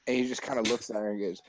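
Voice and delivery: normal talking voice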